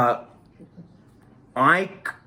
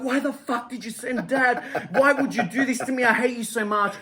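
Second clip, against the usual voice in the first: retold screaming; 10 semitones higher than usual. Child phones mother back about sending Dad, retold high scream. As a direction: re-enact screaming at your mother, pitched way up. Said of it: "I'm screaming at her".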